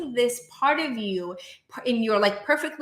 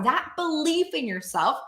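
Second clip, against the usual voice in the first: ordinary delivery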